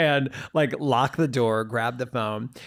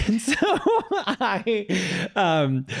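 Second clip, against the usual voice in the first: laughing; 10 semitones higher, much slower than usual. Real, not acted. Storyteller cracking up over her own Uber story, laughing. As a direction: talk through laughter, higher.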